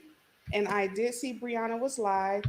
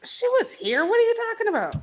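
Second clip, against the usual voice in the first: high, loud disbelieving objection; loud protest; 12 semitones higher, 10 dB louder than usual. Real, not acted. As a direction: contradict her at the top of your voice. Guest loudly contradicts the host.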